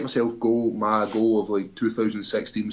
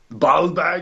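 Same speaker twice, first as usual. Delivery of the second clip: higher-pitched Arnie-accent impression; Arnie-style Austrian accent, played for laughs; 7 semitones higher, 4 dB louder than usual. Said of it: "if you say it in an Arnie accent".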